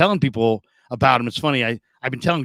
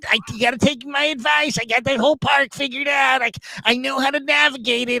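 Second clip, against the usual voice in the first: high-pitched, eager advice-giver impression; Ralph; 11 semitones higher than usual. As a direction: imitate an insistent know-it-all, pitched way up. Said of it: "I'll never forget, though, when I told him".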